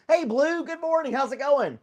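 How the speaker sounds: loud, very high-pitched hello; excited greeting. Real, not acted. Streamer greeting Blue joining the chat, excited high holler. Calling out to arriving viewer.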